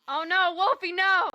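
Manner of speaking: loud, high cry of dismay; mock-horrified cry. Real, not acted. Streamer reacts to Wolfie's redeem, mock-horrified shout.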